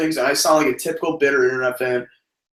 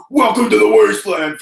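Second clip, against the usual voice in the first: loud growly promo line; grunge promo voice; 6 semitones higher, 5 dB louder than usual. Retold or imitated. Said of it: "you sounded so much like them".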